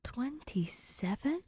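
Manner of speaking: slow, breathless whisper of disbelief; stunned whisper. Acted. Grace whispering back the number in disbelief. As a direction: barely voice it, rising in shock.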